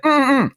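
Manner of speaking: high, closed-mouth mm-mm; a quick hummed summons; signal hum. Shown as a demonstration. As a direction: call a friend over with a hum. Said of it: "like that, and signal him over".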